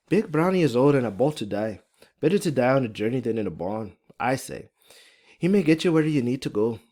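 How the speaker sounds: lilting Irish woman's voice; thick Irish accent. Acted. Fiona speaks of her old horse, thick Irish accent. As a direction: lean into a strong Irish brogue. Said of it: "Irish accent is pretty thick and strong".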